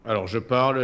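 ordinary talk, nothing marked

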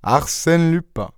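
slow, high, deliberate announcement; grand name reveal. Acted. Daspry answering Varin's demand for his name, slow reveal. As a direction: unveil the name, letting each part land.

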